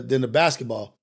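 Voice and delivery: ordinary talk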